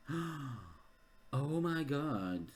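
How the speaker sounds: a slow, hushed gasp of realisation; drawn-out, soft shock; breathless, barely-voiced dismay; stunned gasp. Real, not acted. Spots a familiar spot on map.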